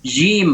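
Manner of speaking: "jim" begun with a flowing zh; soft jim. Shown as a demonstration. Teacher imitates how they pronounce it, zh for j. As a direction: say "jim" as zhim. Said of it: "bukan jim".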